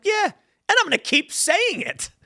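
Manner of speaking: very high, slow mock-stubborn voice; high-pitched hammed-up defiance; gleeful defiance. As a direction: squeal it slowly, proudly unrepentant. Mock defiant answer to pros.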